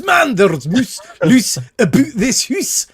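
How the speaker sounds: high, loud, slow Scottish voice; hammed-up Scottish advert line; Scottish accent, drawn out and loud. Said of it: "that's my Scottish".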